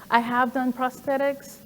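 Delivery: normal talking voice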